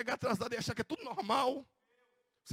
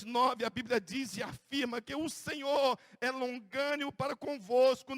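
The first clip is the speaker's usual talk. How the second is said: ordinary delivery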